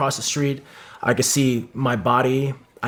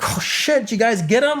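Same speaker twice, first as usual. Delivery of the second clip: much higher, excited retold shout; excited teammates; 10 semitones higher than usual. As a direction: pitch it way up like a buddy amazed after a firefight.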